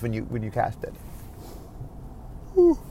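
talking through a yawn. Real, not acted. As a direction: yawn it out mid-sentence. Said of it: "Sorry, yawning today".

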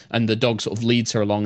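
usual speaking voice

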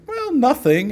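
high-pitched mock dismissal; retold sheepish reply. Sound guys answering his what's in it, high-pitched mock sheepishness. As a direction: jump up high and a little loud.

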